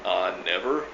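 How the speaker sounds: low start, rising on "never"; deadpan answer. Retold or imitated. Telling commenters when anarchism will start, deadpan. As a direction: state the obvious, voice lifting at the end.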